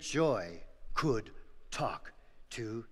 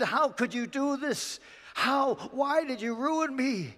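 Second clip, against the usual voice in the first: anguished lament; 10 semitones higher than usual. Acted. Farmer grieves losses.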